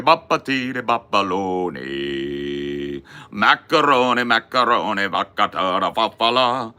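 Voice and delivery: hammed-up cod Italian with laughing ha ha; mock Italian gibberish.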